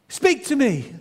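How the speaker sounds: put-on boy demands the voice speak; calling out. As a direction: insist the stranger answer. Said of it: "he said".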